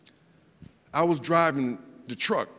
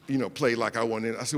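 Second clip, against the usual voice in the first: ordinary talk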